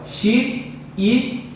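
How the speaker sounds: chi and i split into separate beats; slow syllable-by-syllable split. Shown as a demonstration. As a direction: sound out xiita as chi-i. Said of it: "vai ficar aqui, ó".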